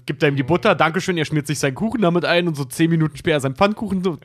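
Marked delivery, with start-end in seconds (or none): none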